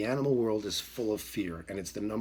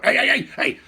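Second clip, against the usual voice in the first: high, very loud repeated yells; re-enacted rapid-fire shouting; chasing shouts; 14 dB louder than usual. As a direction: holler relentlessly to chase a dog. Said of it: "The whole time going".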